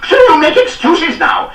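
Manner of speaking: high, loud sneering snap; snapping. A narrator's reading. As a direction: cut him off with scorn. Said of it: "snapped Carden".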